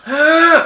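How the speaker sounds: high, loud barked heh; mock angry shout. Shown as a demonstration. Psychologist demonstrating the second pent-up angry yell. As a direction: yell the anger at papa. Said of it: "Teriakan dua yang marah sama papa".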